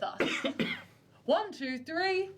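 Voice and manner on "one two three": strained high count mid-lift; lifting together. Acted. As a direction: count it out while heaving. Straining count during group lift.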